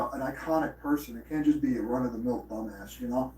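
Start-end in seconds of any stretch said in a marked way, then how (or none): none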